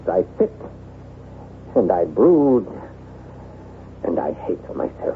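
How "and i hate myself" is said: dropped low and soft, hating himself; bitter self-loathing. Acted. To his friend Maurice, confessing self-hatred, soft and low. Brooding alone in his chateau.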